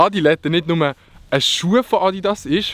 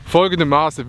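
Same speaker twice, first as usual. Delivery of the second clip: ordinary talk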